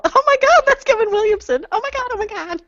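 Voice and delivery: high, breathless gushing over a celebrity; mock starstruck squeal; starstruck fangirl squeal. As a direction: squeal like meeting your idol. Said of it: "'cause I'll be all".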